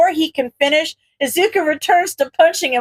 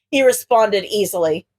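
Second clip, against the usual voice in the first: ordinary delivery, nothing marked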